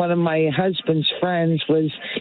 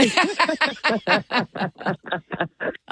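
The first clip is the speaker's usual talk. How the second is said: long burst of high laughter; laughing; 5 semitones higher than usual. Real, not acted. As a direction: laugh out in a quick, repeated staccato. Group laughs at caller's doubt.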